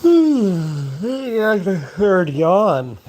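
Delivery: mock-cheer the yawn in a cartoon voice; silly put-on voice. Vlogger cheers his third yawn, slow goofy high voice.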